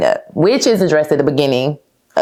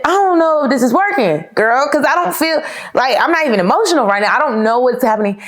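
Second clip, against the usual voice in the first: pitched way up in disbelief; raised-voice mock complaint to the therapist; higher, exasperated retelling; 8 semitones higher than usual. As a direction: retell it high and incredulous. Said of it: "I said".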